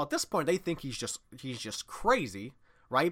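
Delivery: usual speaking voice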